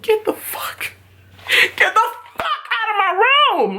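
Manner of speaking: very high, squealed eviction; mock outrage. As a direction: play outraged in a high, stretched voice. Imagined reaction, throwing him out.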